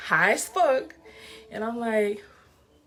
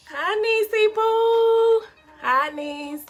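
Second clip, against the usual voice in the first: cutesy greeting; 11 semitones higher, much slower than usual. Real, not acted. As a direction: coo the nickname sweetly. Greeting a viewer sweetly.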